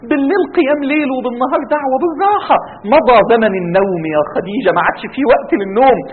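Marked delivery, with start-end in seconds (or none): 0.1-2.6 high-pitched wifely pleading; Khadija's concerned plea. Acted. Wife urging the Prophet to rest, concerned pleading. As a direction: plead with him to take it easy. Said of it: "والسيدة خديجة تقول له".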